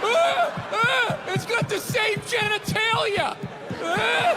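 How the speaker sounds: high squealing mock cheer, faked delight; mock cheering fan. Comic mocks fans cheering blindly, high squealing cheer.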